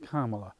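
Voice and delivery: "Kamala" said softly as COMMA-la; "Kamala" as COMMA-la, low and soft; pronounced like comma. Shown as a demonstration. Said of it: "That's how I've been saying it".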